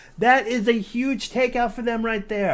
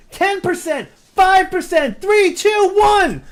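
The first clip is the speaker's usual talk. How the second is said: building from excited to full shout; excited countdown; 7 semitones higher, 5 dB louder than usual. Real, not acted. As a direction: climb to a screaming countdown. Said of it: "Almost lost my voice right there".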